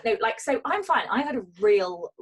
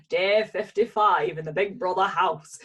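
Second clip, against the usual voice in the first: put-on a half-hearted Big Brother narrator accent; lightly mock the Big Brother narrator's accent; bad Big Brother narrator accent; 6 dB louder than usual. Joking about lockdown in a bad Big Brother narrator accent. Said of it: "That was a terrible accent".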